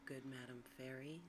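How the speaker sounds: ordinary delivery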